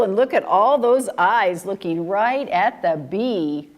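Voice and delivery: ordinary delivery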